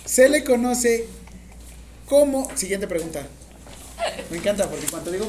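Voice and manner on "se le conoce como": slow, loud, high dictation. Real, not acted. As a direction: dictate it slowly so everyone can write. Teacher dictating exam question to students.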